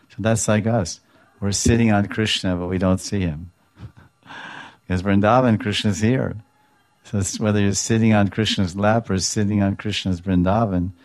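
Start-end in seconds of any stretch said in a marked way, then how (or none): none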